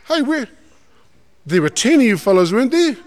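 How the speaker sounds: Jesus asking the healed man where the other nine are, high and surprised; surprised question. Retold or imitated. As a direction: wonder aloud where everyone went. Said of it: "He goes like this".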